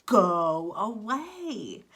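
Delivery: slow drawn-out shouted go away; mock yell. Shown as a demonstration. Teacher demoing the pigeon yelling at duckling, slow mock yell. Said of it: "What is something that I can yell".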